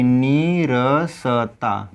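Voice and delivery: नीरसता very slowly as नी, र, स, ता; unhurried syllables. Shown as a demonstration. Teacher showing students how to take time, slow नीरसता. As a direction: take time over each sound of नीरसता. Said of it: "वो आराम से मतलब थोड़ा समय लेकर".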